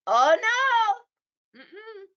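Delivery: high, mock-wailing "oh no"; dismayed cry. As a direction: wail in comic dismay. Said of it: "and then they learned".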